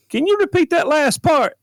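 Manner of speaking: high, loud "repeat that"; a baffled deputy on the radio; light impression of a puzzled deputy; bewildered deputy.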